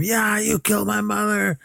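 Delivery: very high-pitched imitation of outrage; high mock scream, no extra volume; mock shrieked accusation. Retold daughter's outburst at killer.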